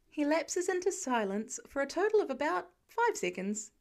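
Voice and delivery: plain reading voice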